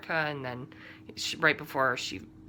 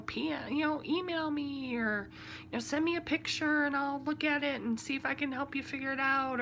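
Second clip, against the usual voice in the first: high, eager helper voice, lightly voiced; imitating the eager crafting community, a bit higher; helpful crafter; 10 semitones higher than usual. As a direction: chirp it like a friendly online crafter. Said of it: "and are like".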